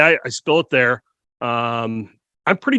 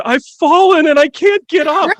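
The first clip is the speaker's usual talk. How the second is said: higher, louder, slow mock cry for help; hammed-up fallen-down plea; 13 semitones higher, 5 dB louder than usual. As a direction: ham it up like the old TV ad plea from the floor. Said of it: "Vision of, like, Steve on the ground".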